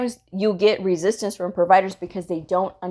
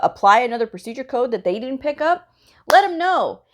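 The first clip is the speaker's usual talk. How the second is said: ordinary delivery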